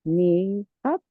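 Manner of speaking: usual speaking voice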